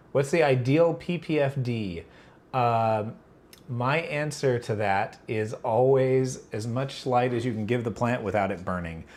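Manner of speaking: usual speaking voice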